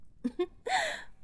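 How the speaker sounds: a short high giggle of contempt; high mocking laugh. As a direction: laugh up high, amused by their anger. Villain laughs at victim.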